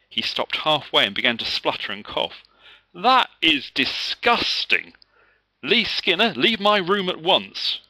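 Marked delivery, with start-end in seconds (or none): 2.9-7.8 loud, high, slow furious order; teacher sending the boy out in disgust. A narrator's reading. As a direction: spit out the disgust and send him out. Teacher spluttering at Lee.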